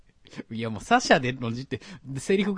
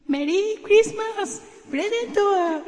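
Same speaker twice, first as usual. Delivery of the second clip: very high, loud, raspy falsetto; hoarse cute bunny; 9 dB louder than usual. Acted. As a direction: strain for a cute rabbit voice and let it rasp. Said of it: "声がガラガラなんだよ". (ガラガラの可愛いうさぎ声)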